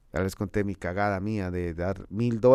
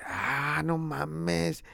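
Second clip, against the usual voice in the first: amazed; 5 semitones higher, 5 dB quieter, much slower than usual. Retold or imitated. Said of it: "Le dije".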